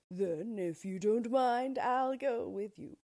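a hint of a timid lion asking to come along. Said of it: "said the Lion".

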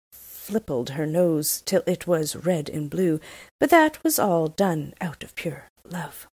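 usual speaking voice

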